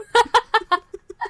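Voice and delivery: loud laughter. Real, not acted. Laughing at hot dog story.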